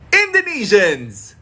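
usual speaking voice

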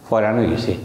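Sylheti ফয়রান, opening on an f sound; Sylheti pronunciation. Shown as a demonstration. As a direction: demonstrate Sylheti dialect, f in place of h. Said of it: "সিলেটের অনেক অঞ্চলে হয়রানকে ফয়রান বলে". (সিলেটি উচ্চারণে, হ-এর জায়গায় ফ)

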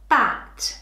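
ordinary talk, nothing marked

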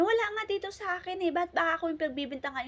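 put-on a child protesting he's being blamed; lightly acted whiny protest; defensive shout. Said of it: "nagsigawan sila".

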